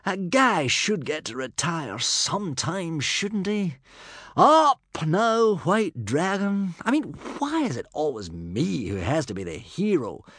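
aggrieved, high-pitched grumble; complaining. A narrator's reading. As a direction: whine in protest, dragging out the last words. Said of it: "complained Humongous".

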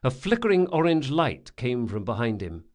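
usual speaking voice

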